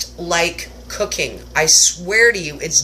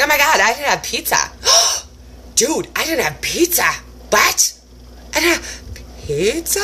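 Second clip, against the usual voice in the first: mock shock; 6 semitones higher than usual. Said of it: "And I was like".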